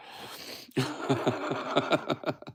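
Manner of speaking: high, rattling wheeze of laughter; wheezy laugh. Real, not acted. Streamer tickled by his own jibe, high wheezing laugh. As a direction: crack up in a long breathy chuckle.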